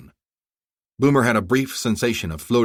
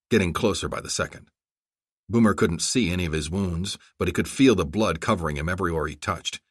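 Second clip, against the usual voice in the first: usual speaking voice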